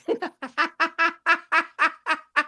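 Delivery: high, light real laughter. Real, not acted. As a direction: laugh high at your own joke. Laughs at own FBI quote.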